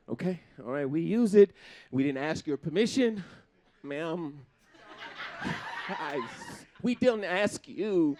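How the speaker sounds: normal talking voice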